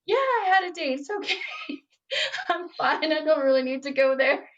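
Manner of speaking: high, giggly polite refusal; retold nervous giggle while declining; laughing brush-off. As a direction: laugh through the excuse, starting high.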